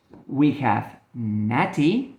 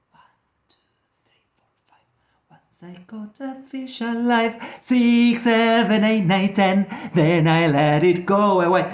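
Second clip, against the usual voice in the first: counting from whisper to loud; 5 semitones higher than usual. Acted. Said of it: "told you to be quiet".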